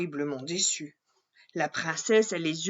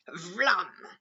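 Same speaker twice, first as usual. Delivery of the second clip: plain reading voice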